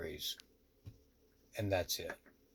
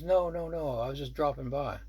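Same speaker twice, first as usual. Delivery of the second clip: imitating a higher, breezy brush-off; casual reassurance; 5 semitones higher than usual. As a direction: wave the worry away in a higher voice. Visitor brushes off his worry.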